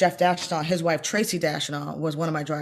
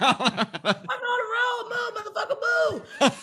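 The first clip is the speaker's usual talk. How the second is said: high laughing yell at the cow; ad-lib yell; 3 dB quieter, much slower than usual. Retold or imitated. Driver yelling at a cow in the road, high laughing shout. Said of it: "that made that up".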